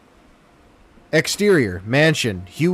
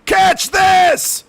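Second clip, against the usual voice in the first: high loud shout; 5 dB louder than usual. Acted. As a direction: yell it up over the noise. Character yells over chopper.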